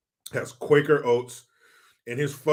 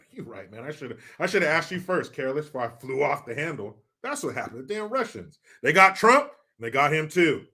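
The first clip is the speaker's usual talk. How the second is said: ordinary delivery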